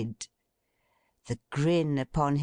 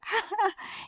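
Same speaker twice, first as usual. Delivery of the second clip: put-on delighted girlish laugh. A narrator's reading. Meg delighted over basket.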